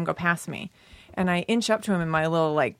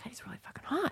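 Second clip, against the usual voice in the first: high, very soft confession; hushed aside; 8 semitones higher, 12 dB quieter, faster than usual. Retold or imitated. Admitting to her friend the driver was hot, hushed. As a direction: confide a secret thought under the story. Retold secret thought about the driver.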